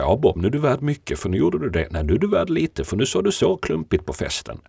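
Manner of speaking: high, animated swings of verdict; mock judging voice. Mocking an imagined fickle, judging God.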